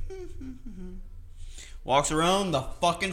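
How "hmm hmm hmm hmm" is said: soft, much higher, unbothered hum; calm humming wife. Retold or imitated. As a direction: hum along like nothing's happening. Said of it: "calm, just like".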